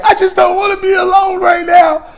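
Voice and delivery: very high, whiny mock wail; crying. Girl imitated explaining her fear of being alone, crying. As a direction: imitate her tearful squealed plea. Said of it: "Starts crying immediately again".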